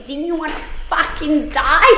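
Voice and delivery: high, loud mocking self-rebuke; a high, loud retold scolding of herself. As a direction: berate yourself, shrill and loud.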